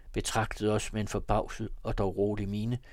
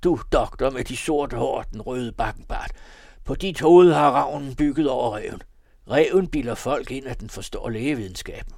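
louder, raised-pitch angry rant; heated accusation, surging louder; shouted tirade; 4 semitones higher, 9 dB louder than usual. A narrator's reading. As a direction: rail at the doctor. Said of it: "skreg Arthur".

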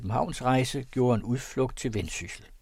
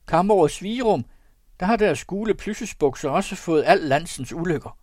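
higher and louder outcry, half mocking; loud exclamation; 7 semitones higher, 6 dB louder than usual. A narrator's reading. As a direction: call it out across the room. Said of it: "det udråb". (et højt og højere udråb)

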